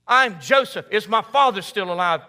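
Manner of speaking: loud, high, fervent declaration; Joseph's stunned reveal. Retold or imitated. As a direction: proclaim it like a revelation. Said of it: "Joseph reveals himself again".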